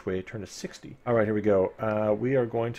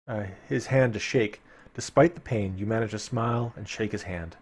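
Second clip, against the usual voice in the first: story narration; 2 semitones higher, 4 dB louder than usual. Acted. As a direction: paint the dank cavern for listeners. Reading gamebook narration.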